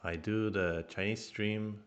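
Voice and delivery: ordinary delivery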